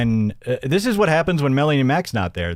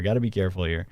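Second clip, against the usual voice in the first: much softer and lower, half to himself; hushed aside; 7 semitones lower, 6 dB quieter, much faster than usual. Shown as a demonstration. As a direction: mutter it under your breath as a mock warning. Repeats warning as a hushed aside.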